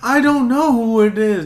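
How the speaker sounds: put-on high, loud kid voice; mock kid. Kid answering mom about online stranger, high mock voice. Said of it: "And you're like".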